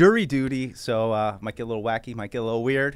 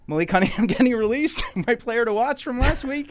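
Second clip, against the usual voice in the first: laughing; 11 semitones higher, 5 dB louder than usual. Real, not acted. Laughs at the player's release.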